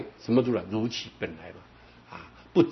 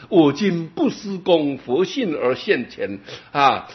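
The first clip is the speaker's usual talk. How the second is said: slow, measured chanting of the verse; verse recital; 4 semitones higher, 4 dB louder, slower than usual. Acted. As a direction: recite each word deliberately. (缓慢庄重地吟诵偈语)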